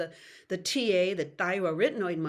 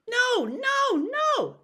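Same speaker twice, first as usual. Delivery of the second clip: high, loud call of No, no, no; 6 dB louder, much slower than usual. Shown as a demonstration. Voice teacher demonstrating a loud outdoor call. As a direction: call No, no, no far away like a child outdoors. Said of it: "I like to start with no".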